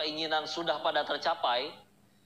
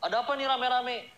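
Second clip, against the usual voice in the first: nosy bystander; 10 semitones higher, 4 dB louder than usual. Retold or imitated.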